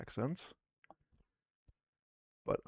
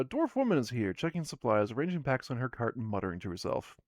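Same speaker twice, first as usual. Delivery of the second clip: ordinary delivery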